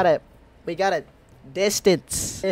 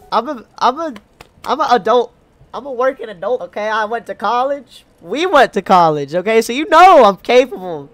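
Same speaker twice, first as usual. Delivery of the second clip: put-on raised, emphatic country accent; 6 semitones higher, 8 dB louder than usual. Player voicing a character defending being a working adult, country accent. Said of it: "Why am I doing a country accent?".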